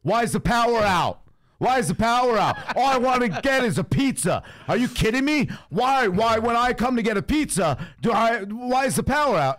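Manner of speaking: high-pitched, indignant mock yell; retold yelling. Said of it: "he's yelling".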